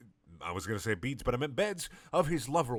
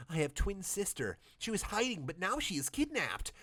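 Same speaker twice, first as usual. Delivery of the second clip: higher panicky plea; higher hammed-up fearful woman; panicked Miranda; 9 semitones higher than usual. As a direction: rush it in mock panic. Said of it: "said Miranda with panic".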